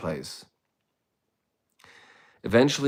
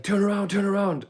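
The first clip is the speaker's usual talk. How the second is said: much higher barked order to turn around; the cop snapping orders at a suspect; police command; 12 semitones higher than usual. Retold or imitated. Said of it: "It's like".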